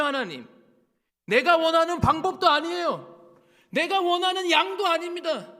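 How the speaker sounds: building to a high, full-voiced shout; angry protest. Man rejecting God's way of answering, shouted protest.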